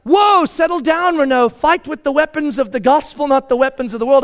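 loud, high urgent exhortation; urgent self-restraint. Pretend. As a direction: rein yourself in, loudly. Imagined self-talk to calm down.

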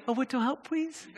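put-on soft, high baby-talk plea; big-eyed toddler asking for help, "please" as "pweese"; little kid. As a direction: imitate a tiny child, rising sweetly at the end. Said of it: "a big-eyed little kid reaching up to you".

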